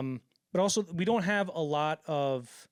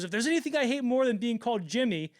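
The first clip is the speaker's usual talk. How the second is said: angry rant; 8 semitones higher, 3 dB louder than usual. Retold or imitated. Cameron yelling at executive again.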